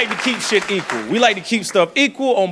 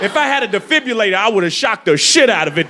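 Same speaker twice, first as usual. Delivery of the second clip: ordinary delivery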